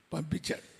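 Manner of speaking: normal talking voice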